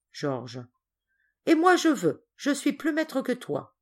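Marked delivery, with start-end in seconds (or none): 1.5-3.8 high-pitched, insistent boast; bossy retort. Acted. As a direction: pull rank like a spoilt child. Cousins quarrel over who commands.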